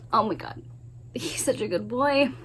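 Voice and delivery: normal talking voice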